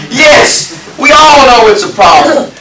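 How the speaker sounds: loud, high preacher's exclamation; exclaiming to the congregation, loud and high; emphatic shout. Real, not acted. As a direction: call it out to the room with force.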